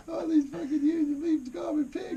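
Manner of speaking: high, loud whiny sob voice; high mock-crying complaint. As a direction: whine it like a wounded friend. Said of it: "And he goes".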